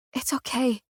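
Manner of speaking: soft hushed reassurance; quiet tender whisper. A narrator's reading. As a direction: breathe it gently to him. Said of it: "I whisper".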